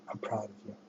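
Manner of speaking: very soft, close to the mic. Retold or imitated. Imitating the woman in the video, very softly. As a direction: murmur it gently like a caring woman. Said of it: "they're like".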